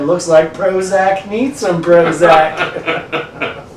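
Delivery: slow Southern drawl through a laugh; deep south accent. Retold or imitated. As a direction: drawl it out like a Southern good ol' boy. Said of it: "that guy with the deep southern drawl comes up to you and says".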